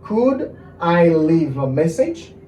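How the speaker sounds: slow, drawn-out teaching delivery; measured language-teacher pace; slow model phrase. Shown as a demonstration. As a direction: spell out each word for learners. Teacher models English phrase slowly.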